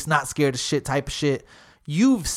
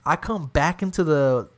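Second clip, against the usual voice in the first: ordinary talk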